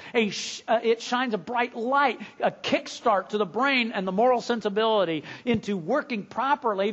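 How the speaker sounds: high, insistent sermon delivery; impassioned preaching. Real, not acted. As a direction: drive the point home from the pulpit. Preacher building to full force.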